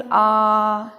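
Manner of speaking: "आ" drawn out long and steady. Shown as a demonstration. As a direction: hold the simple "आ" vowel. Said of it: "लंबा आ चलता है". (लंबा खिंचा हुआ "आ")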